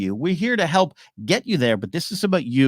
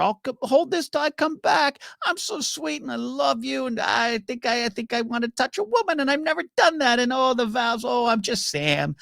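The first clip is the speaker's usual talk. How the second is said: much higher, lovesick innocent voice; sweet naive Sam; 10 semitones higher than usual. Acted. Sam confessing his love to Gilly, sweet and naive. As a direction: gush shyly in a high, sweet voice. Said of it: "sweet old naive Sam".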